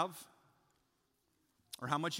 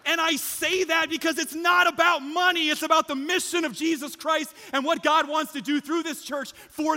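loud, high, impassioned pulpit shout; full-force preaching; 11 semitones higher, 10 dB louder than usual. Real, not acted. Preacher urging the church to be a blessing, full-force preaching. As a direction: drive it out to the whole room, high and loud.

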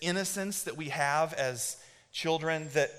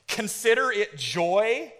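slow, incredulous question, pitched higher; sceptical listeners; 5 semitones higher, much slower than usual. Acted. Some of you hearing the verse, sceptical disbelief. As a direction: question the word joy in disbelief. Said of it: "Some of you are like".

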